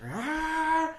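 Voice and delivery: high, loud scream of terror. Retold or imitated. Chainsaw men burst out, scared scream. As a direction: shriek as they attack.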